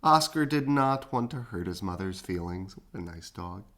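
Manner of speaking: usual speaking voice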